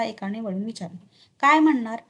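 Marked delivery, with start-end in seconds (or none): 1.4-2.0 loud, higher-pitched guard's comeback; raised-voice challenge; indignant retort. A narrator's reading. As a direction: throw it back at them loudly. Guard retorts to passing strangers.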